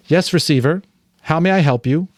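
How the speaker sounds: high, bright intercom voice; speaker voice. Acted. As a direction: answer like a polite machine. Voice from wall speaker.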